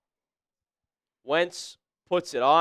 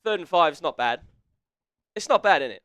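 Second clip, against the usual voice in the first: mock British accent; 3 semitones lower than usual. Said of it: "doing the British accent".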